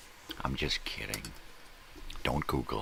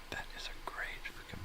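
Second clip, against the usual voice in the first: whispered much softer, as a secret confession; 11 dB quieter than usual. Shown as a demonstration. Whispering his praise of a Shatner film to the audience. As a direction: lean in and hush it. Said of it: "repeats line whispered".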